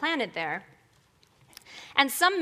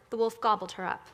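ordinary talk

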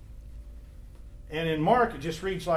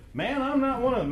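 higher, defensive brush-off to a bystander; Peter's denial; 8 semitones higher than usual. Retold or imitated. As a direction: toss it off like cornered Peter. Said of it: "So maybe Peter looked at one of those bystanders".

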